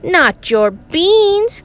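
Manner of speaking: high, drawn-out indignation; outraged cry. A narrator's reading. El Scapito hearing of stolen beans, outraged cry. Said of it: "cried Scapito, outraged".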